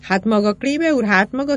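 loud, high astonished cry. A narrator's reading. Cantor cries out at Klébe in disbelief. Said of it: "kiáltott fel a kántor".

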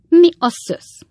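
startled, high and loud outburst; astonished exclamation. A narrator's reading. Klappinsné hears the lodger is coming, astonished. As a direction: exclaim in disbelief, pitch jumping up at the start. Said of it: "kiáltott fel Klappinsné".

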